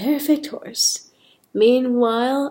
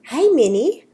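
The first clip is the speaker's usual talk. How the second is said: put-on higher, louder greeting in a light Spanish accent; lightly attempted Spanish accent; 6 semitones higher, 5 dB louder than usual. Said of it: "Sophia's got a bit of a Spanish accent".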